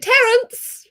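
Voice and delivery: high, loud lady calling the butler; imperious lady of the manor. Pretend. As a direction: summon the butler grandly. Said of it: "And you'd be like".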